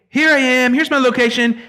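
high chirpy version, said dismissively; high playful here I am; mock location ping. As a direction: pitch it up as the wrong reading. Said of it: "He's not saying".

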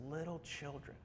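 soft, tender address. Retold or imitated. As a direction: say it gently, like a teacher to dear followers. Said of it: "He just says it so tenderly".

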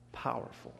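low, soft, hushed voice of God. Retold or imitated. God answering a doubting believer, low and hushed. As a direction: let God's voice drop low.

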